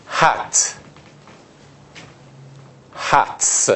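هتس said softly with a clear voiceless s; whisper-soft هت then هتس, the s ending crisp; final s shown. Shown as a demonstration. Teacher shows students the s at the end. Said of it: "به اون صدای س آخر خوب توجه بکنید".

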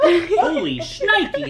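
high, slow, laughing exclamation; surprised. Real, not acted. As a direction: blurt it out in laughing shock.

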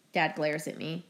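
plain reading voice

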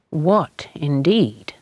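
usual speaking voice

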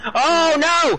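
high panicked cry; panicked shout. Real, not acted. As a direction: yelp in panic. In-game panic.

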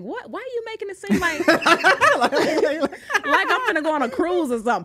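incredulous giggling protest; laughing disbelief. Retold or imitated. Answering the producer's dramatic question, laughing in disbelief.

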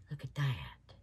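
low whisper of wonder; whispered, amazed; whispered awe. Real, not acted. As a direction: breathe it out in awe. Hushed awe at the mold.

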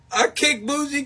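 ordinary delivery

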